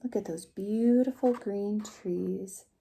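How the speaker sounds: ordinary delivery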